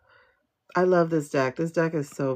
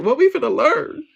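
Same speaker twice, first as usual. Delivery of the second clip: high, louder mock-nervous question; mock scared; 7 semitones higher, 5 dB louder than usual. Reader replaying her worry about the card, mock scared. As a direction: imitate your own nerves, pitched up. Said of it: "I was scared to see what card".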